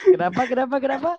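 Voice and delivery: surprised. Real, not acted. Reacting to being called alien.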